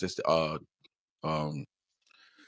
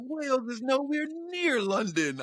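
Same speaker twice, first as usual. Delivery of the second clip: put-on high, slow London-accented protest; very high mock London voice; imitated incredulous Londoner; slower than usual. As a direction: mimic a Londoner in drawn-out disbelief. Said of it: "He was like".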